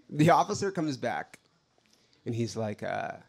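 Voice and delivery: ordinary delivery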